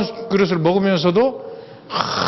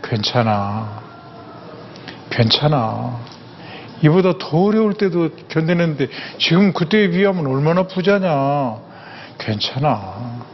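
self-reassurance; 4 semitones lower, slower than usual. Shown as a demonstration. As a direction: soothe yourself gently inside. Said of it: "어떻게 하냐면".

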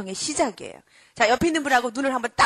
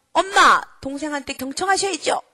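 put-on higher, louder child correcting mum; admonishing child; 4 semitones higher, 6 dB louder than usual. Child to busy mother ignoring the baby, admonishing. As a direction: act out a little one telling mum off. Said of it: "이러면서".

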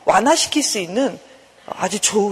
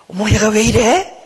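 drawn-out, louder mock scolding; irritated scolding; 4 dB louder than usual. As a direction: scold as a fed-up mother would, dragging it out. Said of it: "막 짜증내고 내 자신을 향해서 꾸짖는 마음".

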